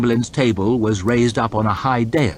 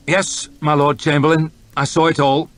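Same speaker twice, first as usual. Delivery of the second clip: stiff reply; 7 semitones higher, 5 dB louder than usual. Acted. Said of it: "said West stiffly".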